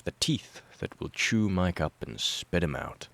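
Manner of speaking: plain reading voice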